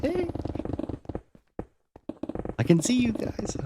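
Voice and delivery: high, chirpy call across the border; cheery call-out. Retold or imitated. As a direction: call out brightly to the Canadians. Waving at Canada from the highway.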